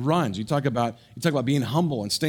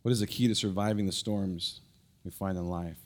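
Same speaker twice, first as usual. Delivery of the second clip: quiet earnest exhortation; 5 semitones lower, 7 dB quieter than usual. Acted. Pastor quietly challenging the congregation, soft and earnest. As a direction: lean in and speak it gently.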